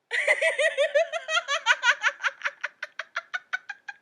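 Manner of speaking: high soft laugh after the punchline. Acted.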